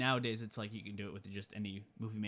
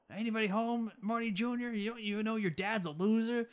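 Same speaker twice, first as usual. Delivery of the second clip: mock bully taunt; 9 semitones higher than usual. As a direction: taunt him like an old bully. Biff taunting Marty Junior.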